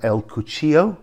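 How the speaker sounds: El Cuchillo as koo-CHEE-yo; double L as Y. Shown as a demonstration. Guide introducing a little town, Spanish Y sound. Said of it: "also with a double L".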